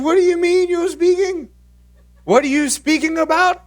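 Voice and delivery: very high, loud mock-mom demand; exasperated mom. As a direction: act out an alarmed mother demanding answers. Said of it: "She was pretty angry".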